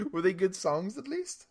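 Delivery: high, slow, laughing ask; amused question. Real, not acted. Amused question, pitch shooting up.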